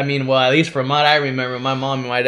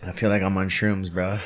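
ordinary delivery

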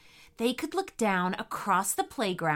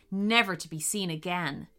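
ordinary delivery

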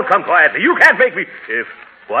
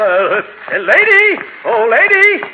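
calling out; 11 semitones higher, 4 dB louder, much slower than usual. Acted. Calling after woman down street.

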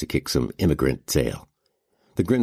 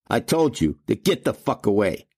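raised, higher-pitched thug warning; mild bellow; 6 semitones higher than usual. A narrator's reading. Burr warns off the man he struck, a mild bellow. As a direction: bellow it with restraint. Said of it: "Burr bellowed".

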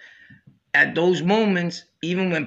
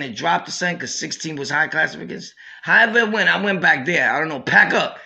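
normal talking voice

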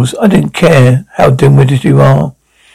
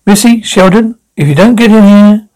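put-on raised voice calling the kids in, higher; 6 semitones higher than usual. Mother calling the kids to come to dinner, raised voice. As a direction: call them from the next room.

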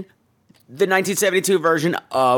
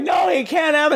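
much higher, hammed-up protest; mock wailing shout; 11 semitones higher than usual. The confessor refusing forgiveness, hammed-up high shout. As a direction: cry it out in mock despair. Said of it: "She's like".

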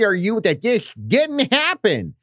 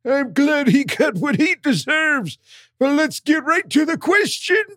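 put-on high, strained pained old man; old man just hit in the groin; 6 semitones higher than usual. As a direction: read it like you just got kicked in the nuts. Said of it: "He wanted an old man that got kicked in the nuts".

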